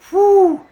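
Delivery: very high, loud relieved whew. Real, not acted. Trooper sitting in car after fright, loud whew of relief. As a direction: blow out relief after the scare.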